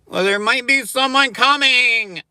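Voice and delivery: very high, loud, slow sing-song; mock drive-thru clerk. Mocking the clerk at the McDonald's window, high sing-song. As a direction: squeak it like a fake-polite cashier. Mimicking worker excuse.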